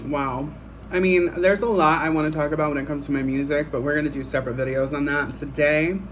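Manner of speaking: ordinary delivery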